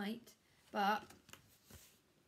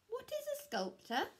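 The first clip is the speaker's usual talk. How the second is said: much higher, puzzled character voice; puzzled Square. Acted. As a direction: ask it up high, baffled.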